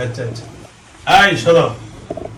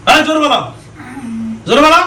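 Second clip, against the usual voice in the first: shouting; 5 semitones higher than usual. Real, not acted. Exorcist commanding possessed boy.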